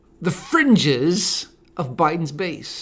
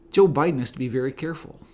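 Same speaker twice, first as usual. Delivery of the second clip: ordinary talk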